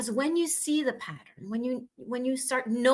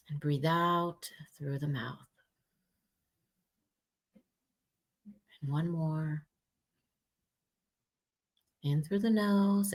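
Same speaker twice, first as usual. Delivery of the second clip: guided meditation; 5 semitones lower than usual. Acted. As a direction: lead a gentle breath exercise. Leads a breathing exercise.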